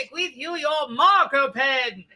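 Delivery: grand proclamation. Acted. Mock grand bestowing.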